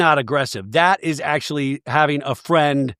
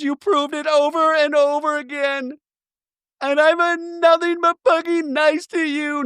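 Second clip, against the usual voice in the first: very high monotone wail; mock tearless crying; 13 semitones higher than usual. Recapper mimicking housewife insisting she's been nice, fake-crying wail. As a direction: sustain a hammed-up high fake sob-voice. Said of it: "No tears still coming out of the eyes".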